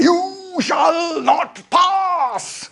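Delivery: high, hugely drawn-out wizard shout; Gandalf. Acted. Wizard planting his staff to bar the way, epic shout. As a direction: bellow it slowly, stretching every word. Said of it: "where Gandalf stands".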